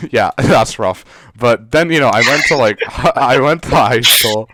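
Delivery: chuckling while telling it; laughing. Real, not acted. Laughing as he tells his cohost about school.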